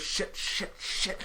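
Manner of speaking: imitated voiceless muttered curses; hissed cursing. Imitating a character out of time defusing, whispered cursing. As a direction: curse under your breath, in repeated bursts. Quoting Viper's panicked voice line.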